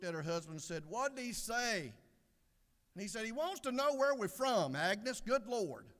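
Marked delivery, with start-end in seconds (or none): 0.9-1.9 slow, high-pitched query; puzzled wife. Acted. Woman looks at her husband for a repeat, slow and high. Wife misses it yet again. 3.3-5.9 loud, high, fed-up outburst; exasperated husband. Acted. Husband tells Agnes where the question points, loudly exasperated. Husband losing patience with wife.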